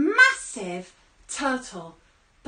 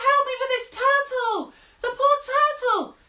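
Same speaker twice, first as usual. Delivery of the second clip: calling for help; 12 semitones higher, 7 dB louder than usual. Acted.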